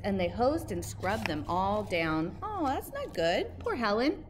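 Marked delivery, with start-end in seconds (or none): none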